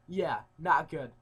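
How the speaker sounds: normal talking voice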